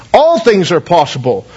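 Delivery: ordinary delivery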